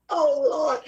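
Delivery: laughing so hard it almost sounds like crying; wheezing laugh that tips into tears; helpless laughter. Real, not acted. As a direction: break up laughing, barely getting the words out. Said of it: "why I'm laughing".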